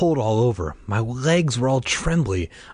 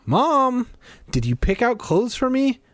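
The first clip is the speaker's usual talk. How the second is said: put-on girl calling her mother from the doorway, raised; 13 semitones higher than usual. A narrator's reading. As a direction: shout for Mom through the house. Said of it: "called to her".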